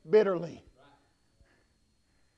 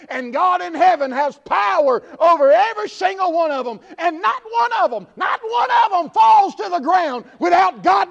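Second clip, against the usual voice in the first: rising, louder and higher revival preaching, shouted at the end; full-force preaching; 7 semitones higher, 7 dB louder than usual. Acted. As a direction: build the sermon into a fiery shout.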